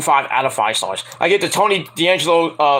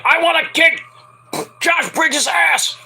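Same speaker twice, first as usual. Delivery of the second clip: high, slow yelled threat; mock angry shout; 11 semitones higher, slower than usual. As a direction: bellow it like a hammed-up heel. Von Wagner wants revenge backstage.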